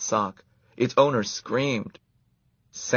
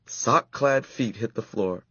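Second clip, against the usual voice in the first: plain reading voice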